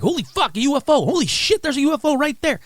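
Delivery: high, loud excited yell; excited shouting. Retold or imitated. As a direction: freak out at the sight. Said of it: "and the whole time he's like".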